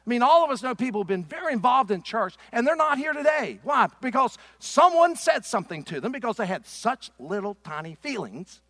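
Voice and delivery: loud, high-pitched pulpit challenge; preaching. Real, not acted. Preacher challenges his congregation over church dropouts, preaching loudly.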